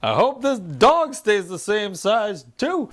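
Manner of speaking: high, slow put-on woman's voice; hammed-up sitcom quip with a high "Ha!"; Agnes. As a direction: imitate a nosy neighbour, drawn out and theatrical.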